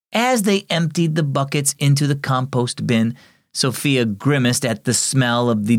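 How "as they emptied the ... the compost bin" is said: plain reading voice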